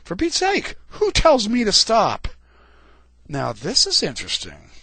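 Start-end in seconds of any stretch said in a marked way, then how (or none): 0.0-2.3 high, indignant startled reply. Retold or imitated. Abraham startled by the angel's command, high surprised retort. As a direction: jump in pitch as if caught off guard. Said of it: "He looks up startled and he goes".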